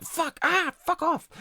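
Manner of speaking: imitating his own shower scream; mock shower scream. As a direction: yelp it out like a sudden bad memory. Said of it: "what I call a shower scream".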